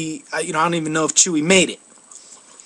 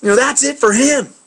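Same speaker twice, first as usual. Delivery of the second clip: imitating the angry stranger; 7 semitones higher than usual. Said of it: "the guy's like".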